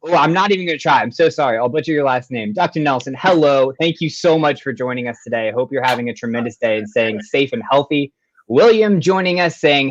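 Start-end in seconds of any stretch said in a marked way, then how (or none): none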